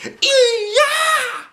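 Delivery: piercing high kiai yell; karate scream. Acted. Girl showing the class her scream, loud high kiai. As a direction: let out a shrill karate cry. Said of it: "screamed Andrea".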